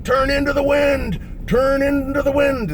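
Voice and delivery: high, stretched-out shout; megaphone yelling. Retold or imitated. Instructors in Jeeps yelling to descending jumpers, long shouted call. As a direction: yell it slowly like a megaphone call. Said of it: "they got these megaphones and they're yelling".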